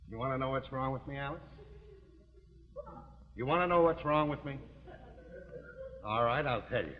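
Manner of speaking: low, held-back confession; grave confession. Acted. Husband confesses leg trouble to wife, grave low voice. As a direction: drop the voice and reveal it gravely.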